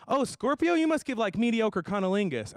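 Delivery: high, bright-eyed mock voice; high mock fortune teller voice; mock high gushing. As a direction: squeal it like an excited fan. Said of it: "they would've said something like".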